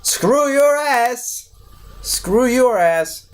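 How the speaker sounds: high, slow hammed-up shout; mock yell. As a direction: drag it out like a cartoon hero yelling. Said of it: "And he's like".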